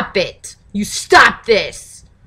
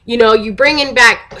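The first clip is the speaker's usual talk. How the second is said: ordinary talk, nothing marked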